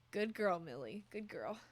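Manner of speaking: ordinary talk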